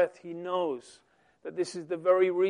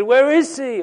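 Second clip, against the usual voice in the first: loud, higher demand; demanding shout; 7 semitones higher, 11 dB louder than usual. Acted. Leaders demanding of officers, loud and high. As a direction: snap the question at them.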